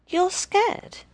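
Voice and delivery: high, mocking question; taunting. A narrator's reading. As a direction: tease him with it. Kids taunting the boy.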